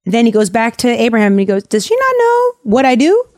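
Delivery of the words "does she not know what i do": way higher, mock-offended God voice; indignant God. God scoffing at Sarah's doubt, high mock indignation. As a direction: squeak it out like an affronted deity.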